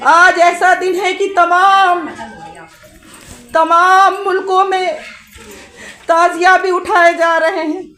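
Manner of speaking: rising, fervent preaching; impassioned majlis sermon. Real, not acted. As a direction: preach to stir the mourners. Majlis preaching at full force. (जोशीला, ऊँचे स्वर में मजलिस का बयान)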